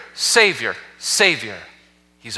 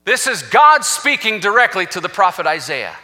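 usual speaking voice

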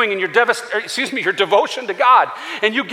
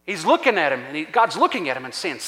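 usual speaking voice

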